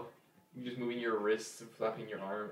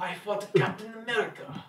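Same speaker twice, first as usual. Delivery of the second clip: high, stiff Russian soldier; a hint of a Russian soldier accent; 8 semitones higher, 3 dB louder than usual. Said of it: "I, I got one".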